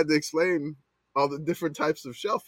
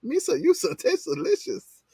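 Jar Jar voice. Pretend. Jar Jar impression.

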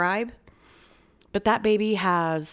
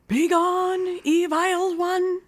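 mock exorcist; 11 semitones higher, much slower than usual. Mock banishing a mini-me.

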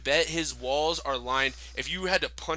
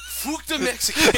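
high-pitched, muddled Mexican-Scottish parody; hammed-up outburst in a hybrid Mexican-Scottish accent; mock Mexican-Scottish accent; 12 semitones higher, 6 dB louder than usual. Friends piling on the neighbor impression, mixed Mexican-Scottish accent. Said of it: "He did both".

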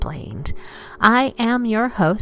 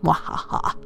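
unvoiced evil laugh; breathy villain cackle; mad scientist; 4 semitones lower, 3 dB quieter than usual. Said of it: "I gotta throw in a mwahaha".

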